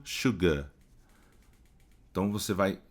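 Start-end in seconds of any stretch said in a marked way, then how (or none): 0.0-0.7 "sugar" ending in a long open a, no r; the British "sugar"; British English. Shown as a demonstration. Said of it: "E no inglês britânico".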